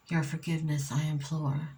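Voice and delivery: ordinary delivery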